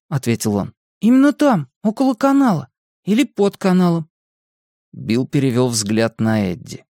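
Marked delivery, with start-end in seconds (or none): 1.0-4.1 put-on high-pitched boy's voice; emphatic confirmation. A narrator's reading. Boy tells friends it is by the canal, emphatic.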